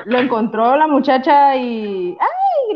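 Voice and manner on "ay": very high shrieked "¡ay!"; retold scream. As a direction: imitate the girl's shriek at finding the toad. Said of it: "Gritó, ¡ay!".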